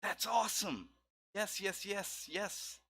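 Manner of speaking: high, thrilled yes yes; excited. Real, not acted. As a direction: squeal with delight. Host thrilled by artwork.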